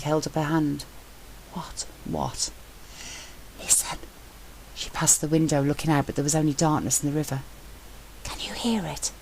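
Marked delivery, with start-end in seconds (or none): 1.5-4.0 hushed, voiceless word; a woman silencing him to hear a sound; whisper. Acted. Hushing him at window. 8.2-9.1 hushed, voiceless word; a woman silencing him to hear a sound; whisper. Acted. Whispering at the window.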